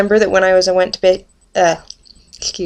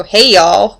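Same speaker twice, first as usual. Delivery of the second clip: a louder, drawn-out southern "Hey, y'all"; 6 dB louder than usual. Shown as a demonstration. Showing the viewers where her southern accent slips out, a loud southern greeting. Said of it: "that's where you hear it at".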